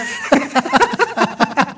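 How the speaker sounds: shrill burst of laughter; high squealing laugh. Real, not acted. After the teasing. (risa aguda)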